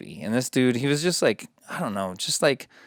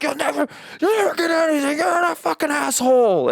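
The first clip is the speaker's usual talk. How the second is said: put-on much higher, louder enraged old-man yell; furious shout; 4 dB louder than usual. Retelling the old owner's blow-up, imitated furious yell. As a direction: scream it like he's lost his mind. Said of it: "the dude just fucking went off the handle".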